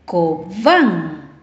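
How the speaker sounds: "Cobán" drawn out, voice swelling on final "bán"; stress on last syllable. Shown as a demonstration. Teacher giving an example to listen to, long stressed "bán" in "Cobán". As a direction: split "Cobán" slowly and lean on "bán". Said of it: "La rayita la lleva en la última sílaba".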